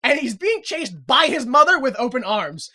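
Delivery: ordinary delivery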